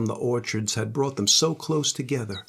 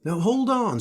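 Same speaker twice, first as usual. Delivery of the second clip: protesting Johnny; 9 semitones higher than usual. Acted. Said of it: "said Johnny".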